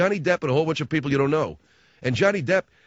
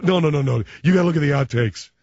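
quick overriding objection, slightly louder; insistent movie fan; 4 dB louder than usual. Acted. Fan correcting a friend about DVD outtakes, insistent. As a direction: cut the friend off, lightly hammed-up.

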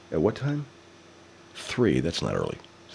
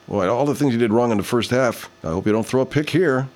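ordinary talk, nothing marked

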